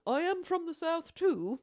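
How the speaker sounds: high, loud mock British accent; playful British tour guide impression. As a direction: put on a chirpy English accent. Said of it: "he started it off with".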